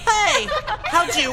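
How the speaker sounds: high outraged yell; shocked, furious shout. Real, not acted. Secret exposed, outraged outburst.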